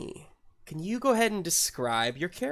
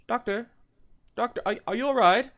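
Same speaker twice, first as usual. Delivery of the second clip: lightly voiced worried questioning, higher pitch; higher, hesitant concern; 8 semitones higher, faster than usual. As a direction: check anxiously on the doctor. Voicing concerned character to the doctor.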